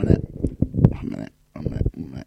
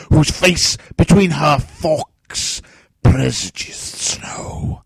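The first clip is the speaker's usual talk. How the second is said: slow, declamatory stage tragedian; raging King Lear; 3 semitones higher, 6 dB louder, slower than usual. Acted. Actor as mad old king reciting a favourite play, raging. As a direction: swing from booming outrage to whispered disgust.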